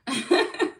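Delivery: short, high, loud laugh; quick burst of real laughter; amused laugh. Real, not acted. Amused at accent guess.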